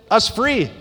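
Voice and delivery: normal talking voice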